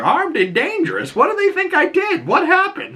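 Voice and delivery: very high, frantic disbelief; hammed-up freakout in a squeaky voice; panicked squeak. Matt on the roof hears he's armed and dangerous, squeaky panic. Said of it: "he's like".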